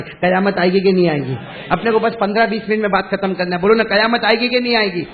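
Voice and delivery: loud, rising call to the congregation; rousing preacher's call-out; preaching at full force. Acted.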